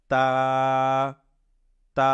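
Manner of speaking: "তা" with a long, level "aa"; model pronunciation. Shown as a demonstration. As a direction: stretch each "তা" evenly, pausing between. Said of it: "উচ্চারণ করার সময় বলবো".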